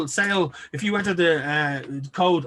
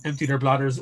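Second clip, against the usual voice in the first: ordinary talk, nothing marked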